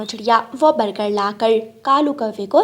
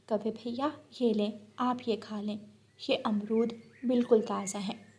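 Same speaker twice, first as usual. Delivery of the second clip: much softer, tender bird voice; sweet bulbul; 11 dB quieter than usual. Acted. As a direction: bulbul kindly offering fresh guavas. Bulbul offers guavas. (बहुत धीमी, मीठी बुलबुल की आवाज़)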